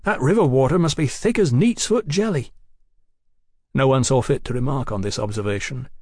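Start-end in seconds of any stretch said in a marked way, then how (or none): 0.0-2.5 raised, wondering voice; amazed exclamation; marvelling. A narrator's reading. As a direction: marvel at it, pitch swooping up. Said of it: "Tom marvelled".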